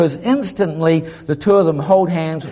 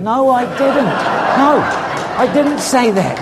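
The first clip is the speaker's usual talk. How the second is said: laughing protest; 8 semitones higher than usual. Retold or imitated.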